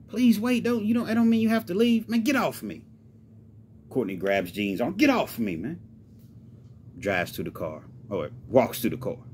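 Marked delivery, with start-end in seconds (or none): 0.1-2.1 urgent pleading. Acted. Acted plea as Gene storms off. 2.1-2.8 high, angry shake-off; indignant brush-off, raised pitch; furious. Acted. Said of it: "he's furious. He storms off". 4.9-5.8 angry, raised-pitch protest; heated, indignant brush-off; furious. Acted. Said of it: "he's furious. He storms off".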